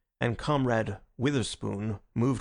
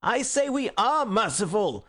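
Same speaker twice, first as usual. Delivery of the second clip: furious; 9 semitones higher than usual. Acted. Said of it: "repeated Gregory furiously".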